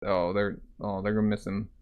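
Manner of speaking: plain reading voice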